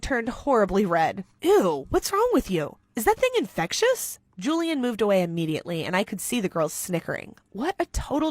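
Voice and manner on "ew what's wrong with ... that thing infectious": disgusted sneer. Acted. Boy jeering at a blushing classmate, disgusted. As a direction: recoil in mock disgust. Julian mocks blushing girl.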